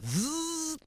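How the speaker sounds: a high, quiet buzzing noise for welding sparks; quick high welding sound effect; mock welding buzz. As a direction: imitate a welder's hiss, thin and high.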